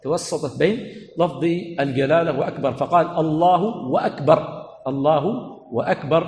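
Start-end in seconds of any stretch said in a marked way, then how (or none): 3.1-6.3 "أكبر" preceded by "wa", keeping its glottal stop; "Allahu wa-akbar", both w and hamza sounded in "أكبر"; waw plus hamza. Shown as a demonstration. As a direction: say "وَأكبر" slowly after "الله". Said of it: "بالواو مع وجود الهمزة".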